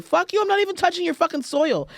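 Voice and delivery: high-pitched, indignant comeback; indignant retort. Acted. Traveler telling off airport immigration, indignant. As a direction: fire back in a high, offended voice.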